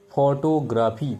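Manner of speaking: teacher slowly pronouncing photography for students; slow model word. Shown as a demonstration. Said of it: "যেমন".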